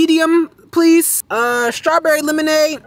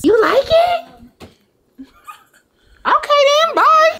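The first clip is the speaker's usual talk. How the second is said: high sing-song pet talk; baby talk; 13 semitones higher, much slower than usual. Acted. Talking sweetly to the pet.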